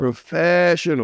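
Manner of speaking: high, slow drawn-out mock praise; hammed-up high slow compliment; mock posh echo. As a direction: stretch it out way up high, teasing. Echoing partner teasingly.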